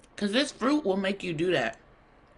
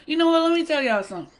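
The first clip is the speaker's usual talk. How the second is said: higher and louder sassy retort; sassy comeback; 7 semitones higher, 3 dB louder, much faster than usual. Real, not acted. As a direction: call out the viewers with attitude. Snaps back at laughing viewers.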